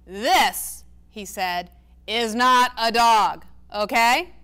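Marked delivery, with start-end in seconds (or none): 0.0-0.8 slow, deliberate. A narrator's reading. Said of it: "spoke slowly". 2.1-4.3 slow and louder, spelling it out; slow, deliberate. A narrator's reading. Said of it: "spoke slowly".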